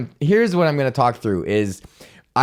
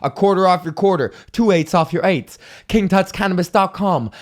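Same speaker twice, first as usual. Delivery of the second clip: ordinary talk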